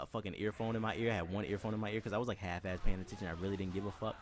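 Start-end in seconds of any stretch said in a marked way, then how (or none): none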